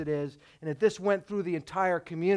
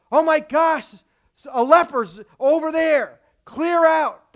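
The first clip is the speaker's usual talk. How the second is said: higher, louder panicky outcry; alarmed; 7 semitones higher, 8 dB louder than usual. Retold or imitated. Townsfolk spotting a leper, alarmed high and loud. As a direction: sound startled and urge everyone away.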